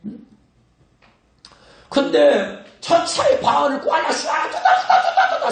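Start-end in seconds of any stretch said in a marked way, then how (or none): 2.8-5.5 squealing high babble mocking tongues; mock tongues-speaking. Pastor mocks members babbling in tongues for hours, high squeals. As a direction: parody endless speaking in tongues.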